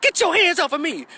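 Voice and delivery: high-pitched mock yell; mimicked yelling woman. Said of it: "and they're yelling".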